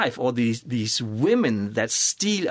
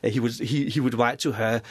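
ordinary delivery

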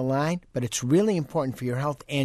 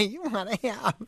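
laughing; 9 semitones higher than usual. Real, not acted. Laughs ending his joke.

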